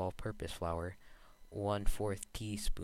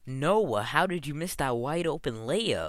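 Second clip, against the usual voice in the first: loud, higher frustrated yell; angry yelling; 7 semitones higher, 9 dB louder than usual. Acted. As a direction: yell at a teammate's blown layup. Yelling over missed video game layup.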